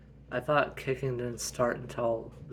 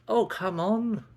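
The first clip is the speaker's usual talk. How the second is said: high exasperated Kendall; 9 semitones higher, 8 dB louder, slower than usual. Acted. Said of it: "She's like".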